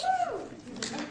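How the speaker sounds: soft breathy laugh; a short nervous giggle. Real, not acted. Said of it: "Are you laughing?".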